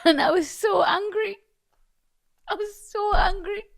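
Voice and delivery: high, squeaky words through laughter; helpless giggling. Real, not acted. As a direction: struggle to speak while cracking up. Trying to talk through laughing fit.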